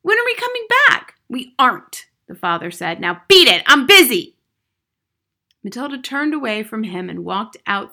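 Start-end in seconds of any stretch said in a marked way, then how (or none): 0.0-1.1 put-on very high, loud child's plea; crying out. A narrator's reading. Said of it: "cried Matilda". 1.3-2.1 louder, slightly raised reply; curt father. A narrator's reading. Father cutting off child. 3.0-4.4 very loud, brusque father; angry dismissal. A narrator's reading. Father dismissing child angrily.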